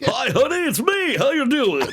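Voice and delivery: high, booming hammed-up announcer greeting; announcer voice at home. Announcer calling home to honey, over-the-top broadcaster voice. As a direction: greet a spouse like calling a prizefight. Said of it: "he calls home".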